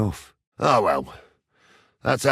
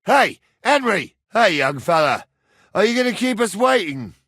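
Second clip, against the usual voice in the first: high, raised hailing shout; calling out; 7 semitones higher, 4 dB louder than usual. Acted. Man hailing a young fella who keeps him waiting, calling out. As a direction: hail someone some way off.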